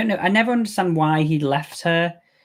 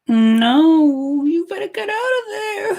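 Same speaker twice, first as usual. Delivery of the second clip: much higher, with a crying edge; pleading in fear; 3 dB louder, slower than usual. Real, not acted. Warning the woman on screen, a high, tearful plea. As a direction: beg the character to run.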